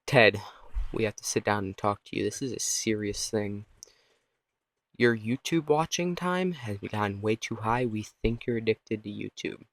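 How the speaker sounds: softly serious, like a parent staging an intervention; grave, hushed parent voice; stern parent. Pretend. Parents confronting kid, acted.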